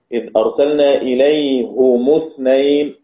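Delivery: slow, إليهم اثنين as "ilayhumu-thnayn"; double damma reading. Shown as a demonstration. Teacher recites Hamza and Kisai's reading, إليهم as "ilayhumu" with double damma. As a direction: recite إليهم slowly with u after both h and m. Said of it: "قراءة حمزة والكسائي".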